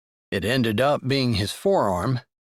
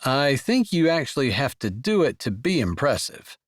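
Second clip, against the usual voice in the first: ordinary delivery, nothing marked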